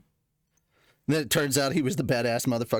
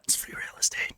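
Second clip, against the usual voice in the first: whispering; 6 dB quieter than usual. Retold or imitated. Meme quote whispered.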